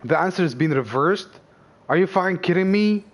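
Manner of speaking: ordinary delivery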